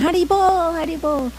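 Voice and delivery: very high, imitated happy call; group cheer. Devotees answer the weak old mother, imitated high cheer. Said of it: "And they all went".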